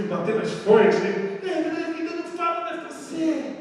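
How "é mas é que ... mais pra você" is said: mock comeback. Acted final comeback.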